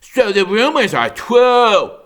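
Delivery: put-on high, loud jeering young bear; mocking bear voice. A narrator's reading. Young bear taunting the goosling, loud and mocking. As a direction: sneer it out like a cocky bully. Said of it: "Nettle laughed".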